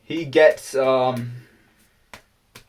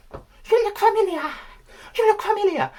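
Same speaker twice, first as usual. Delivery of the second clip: high, put-on squeaky voice, repeating herself; very high mock lady voice; squeaky character voice. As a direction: play a puzzled lady in a very high voice. Chinese voiced in retold story.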